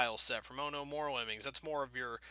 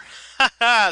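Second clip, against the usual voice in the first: loud laugh; 7 semitones higher, 12 dB louder than usual. Real, not acted. Laughs at own joke.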